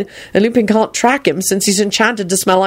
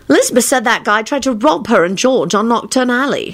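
put-on higher, offended girl; sniffy; 5 semitones higher than usual. A narrator's reading. Daughter telling on someone to father, sniffy. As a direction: report it with haughty disdain. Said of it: "she told her father with a sniff".

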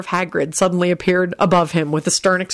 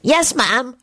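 stammering; 7 semitones higher than usual. A narrator's reading. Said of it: "Timothy stammered out".

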